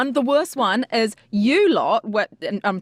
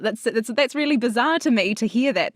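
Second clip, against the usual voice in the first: ordinary talk, nothing marked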